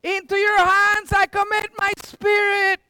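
high, sustained yell with a last breath. Acted. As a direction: yell it upward to the Father, high-pitched. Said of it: "he yells out".

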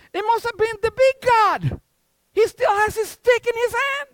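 high, loud, put-on boyish excuse; feigned innocence. Son blaming the big god to dad, feigned innocence. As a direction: exclaim it as the son blaming someone else.

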